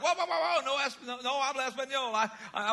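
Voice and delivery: high, loud, laughing "whoa", backing off in a panic; flustered laughing protest. Retold or imitated. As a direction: throw up your hands, overwhelmed and laughing. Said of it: "And I was like".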